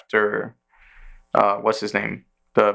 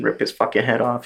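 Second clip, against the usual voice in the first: ordinary talk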